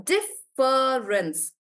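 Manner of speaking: "difference" drawn out slowly, each part pulled apart; slow syllable check. Shown as a demonstration. Teacher testing the syllables of difference aloud. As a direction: stretch the word to count its beats. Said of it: "Dif-, no".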